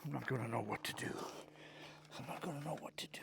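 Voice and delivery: fast breathy whisper. Real, not acted. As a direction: whisper it to yourself, hurried. Whispered to himself while hauling.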